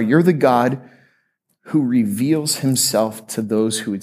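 low, measured praying voice; prayer. Real, not acted. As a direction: pray earnestly on behalf of the congregation. Leads a prayer.

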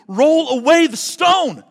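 a commanding call to come out to life; voice of Jesus calling. Acted. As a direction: boom it out like Jesus at the tomb. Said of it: "hear his voice say to us".

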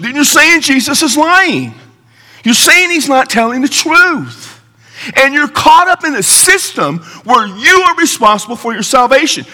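high, insistent pulpit voice; emphatic preaching. Real, not acted. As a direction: drive each point home to the congregation. Preacher challenges the congregation.

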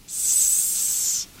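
long unvoiced hiss like a snake. A narrator's reading. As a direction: hiss through the teeth like a snake.

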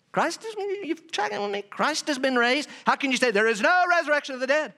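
high-pitched acted disbelief; incredulous. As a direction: retort as if you cannot believe your ears. Acting out Paul's incredulous retort.

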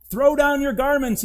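high, loud, indignant demand; offended donkey shouting at the villagers; cross donkey. Acted. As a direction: bark the order at the townsfolk. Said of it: "he said crossly".

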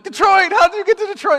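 high, loud disbelieving shout; shocked dad. Retold or imitated. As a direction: blurt it out in total disbelief.

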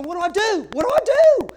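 anguished, voice rising to a high cry; desperate cry. Retold or imitated. Husband alone at night, anguished cry. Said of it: "I'm thinking".

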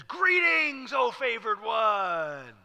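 high, loud, slow proclamation; a sudden grand angelic hail; booming angel greeting. Acted. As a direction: announce it like a herald bursting in. Angel suddenly appears to Mary.

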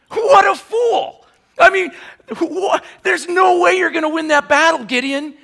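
scornful mocking shout. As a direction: yell it like a scornful soldier. Shouting mockery at Gideon.

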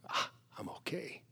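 soft whispered sigh of relief; relieved whisper. Acted. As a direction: breathe it out relieved. Relief after comparing giant to God.

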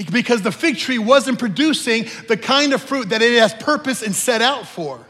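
loud, rising exhortation to the church; preaching at full force. Real, not acted. Preacher urging the church to nurture God's word, loud and fervent. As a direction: push every line out to the congregation.

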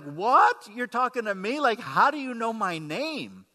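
a man stunned that Jesus knows his name; startled disbelief. Retold or imitated. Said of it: "I'm sure he's like".

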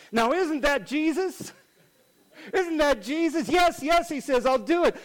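high-pitched, delighted exclamation; excited acceptance. Retold or imitated. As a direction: burst out with eager wonder. Retold eager acceptance after pause.

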